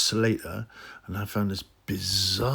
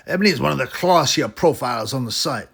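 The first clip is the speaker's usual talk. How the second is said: raised, louder mocking reviewer read; 4 semitones higher, 3 dB louder than usual. Vlogger mocking the reviewer's review, pitched up and louder. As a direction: read it out like a leering fan, pitched up. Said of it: "this pervert goes on to write".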